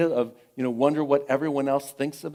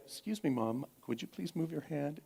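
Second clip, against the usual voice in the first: much softer, carefully polite request; soft, deliberate courtesy; quoting daughter's clear politeness; 2 semitones higher, 10 dB quieter than usual. Retold or imitated. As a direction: imitate a daughter calmly asking mom. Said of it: "that moment of clarity".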